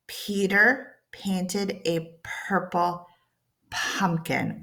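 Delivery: very slow, careful tongue twister. Shown as a demonstration. Teacher reading the p tongue twister slowly. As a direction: ease through every p slowly. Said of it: "Notice how slow I'm going".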